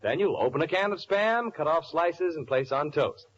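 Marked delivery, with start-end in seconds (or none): none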